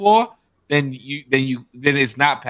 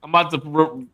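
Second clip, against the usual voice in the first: ordinary talk, nothing marked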